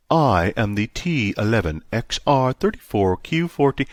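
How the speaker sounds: haughty machine voice; snooty computer voice. A narrator's reading. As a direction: sound bored and superior. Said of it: "a snooty voice said".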